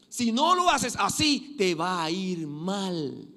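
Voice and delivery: high, loud pulpit preaching; impassioned sermon voice; fiery preaching. Acted. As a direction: drive the warning home to the church. Preaching at full force.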